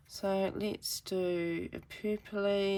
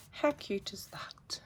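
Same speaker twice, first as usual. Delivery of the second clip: whisper; 3 semitones higher, 6 dB quieter than usual. Real, not acted. Cooing over finished card.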